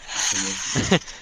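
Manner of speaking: breathy hissing wheeze; hoarse raspy laugh. Pretend. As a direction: push air out in a wheezy hiss. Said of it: "That's just a hiss".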